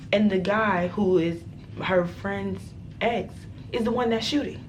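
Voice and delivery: plain reading voice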